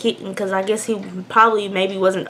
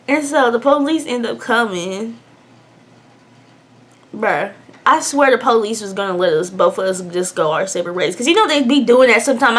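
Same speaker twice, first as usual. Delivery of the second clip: ordinary delivery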